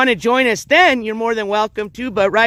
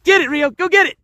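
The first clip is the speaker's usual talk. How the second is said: high, keen command; pitched-up fetch call; urging to fetch; 7 semitones higher, 4 dB louder than usual. Real, not acted. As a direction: send the dog off eagerly. Sending dog to fetch.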